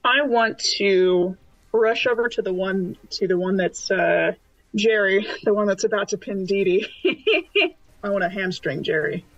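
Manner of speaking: usual speaking voice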